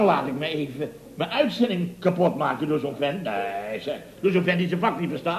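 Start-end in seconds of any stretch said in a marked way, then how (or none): none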